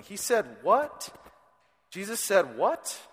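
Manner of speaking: slow, high, stunned asking; as if the disciples can't believe it; incredulous disbelief. Retold or imitated. Disciples hearing Jesus, slow stunned disbelief. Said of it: "were thinking".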